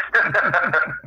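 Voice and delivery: a bright burst of laughter; amused high laugh. Real, not acted. Laughing at the joke.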